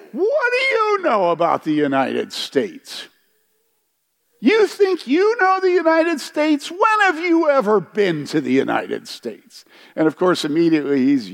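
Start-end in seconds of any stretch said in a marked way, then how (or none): 0.1-9.6 high, challenging heckle, pitched way up; retold heckle. Heckling a British speaker across the crowd, high and challenging. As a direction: throw the question at him, raised and scornful.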